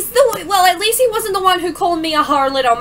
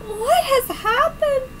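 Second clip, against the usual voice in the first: mock swoon; 10 semitones higher, 3 dB louder, slower than usual.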